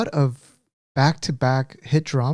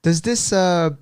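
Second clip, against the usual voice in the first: higher-pitched mock wife asking; imitating wife; 5 semitones higher than usual. Husband mimicking wife asking about a drama character, high-pitched. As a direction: put on her voice, raised in pitch. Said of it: "And you're like".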